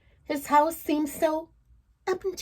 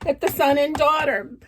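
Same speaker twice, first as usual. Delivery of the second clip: plain reading voice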